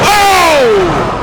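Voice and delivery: high, drawn-out falling "oh"; wrestling announcer's high exclamation; startled excited cry. Real, not acted. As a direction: react to the sudden hit. Reacting to another hit.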